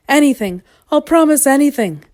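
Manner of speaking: high, loud begging; frantic, high-pitched dragon plea; desperate pleading. A narrator's reading. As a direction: plead loudly for the tickling to stop. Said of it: "pleaded the dragon".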